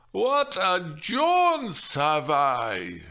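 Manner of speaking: slow, loud, heartfelt grumble; complaining. Acted. The nurse complaining of her trip, slow and loud. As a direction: whine about the journey. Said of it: "she complained".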